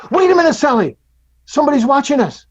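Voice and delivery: put-on high, loud, sudden child's warning; alarmed child. Heather stops Sally taking the cookie, alarmed and high. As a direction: stop her sister in sudden alarm. Sister warns during theft.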